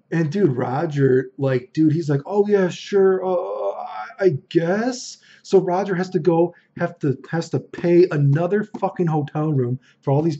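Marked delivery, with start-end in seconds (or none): 2.2-5.2 higher, hesitant mock consent; reluctant agreement. Rich friend pressed to host strangers, reluctant agreement. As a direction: agree unwillingly, stalling on the way.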